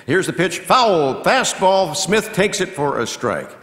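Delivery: loud, high play-by-play burst; excited pitch call. Real, not acted. Announcer calling a foul fastball, excited and loud. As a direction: call it sharply as it happens.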